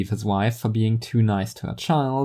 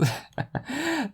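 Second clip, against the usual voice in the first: amused laugh; 7 dB quieter than usual. Acted.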